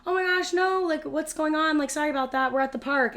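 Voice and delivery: imitating a babysitter, high and loud, apologetic; flustered babysitter. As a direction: squeal the apology like a caught-off-guard sitter. Babysitter voiced answering frantic mother.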